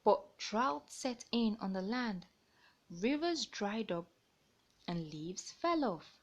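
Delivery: lively picture-book narration; reading to children. A narrator's reading. Storyteller reading a bird tale to young listeners. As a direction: read it out like storytime.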